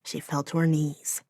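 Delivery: ordinary delivery, nothing marked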